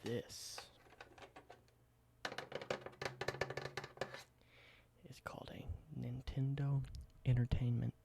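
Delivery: soft, close whispered ASMR roleplay. Acted. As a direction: whisper it gently like showing an old relic. Whispered ASMR show-and-tell roleplay.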